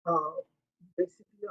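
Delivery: normal talking voice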